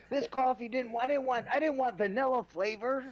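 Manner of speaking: very high-pitched put-on complaint; mock whining. Mocking someone upset over the wrong coffee, whining. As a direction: whine it way up high, hammed-up.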